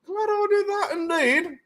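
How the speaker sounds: high, slow self-pleased voice; smug posh voice. Retold or imitated. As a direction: gloat over a smart purchase. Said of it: "It's like".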